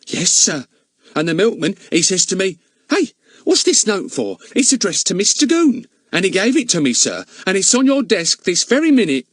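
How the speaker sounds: high, chatty housekeeper protesting. Acted. Charwoman contradicting the village policeman, high and eager. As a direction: pitch her up like a bustling charwoman.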